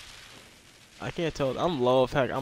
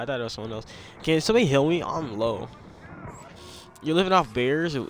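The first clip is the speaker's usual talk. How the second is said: usual speaking voice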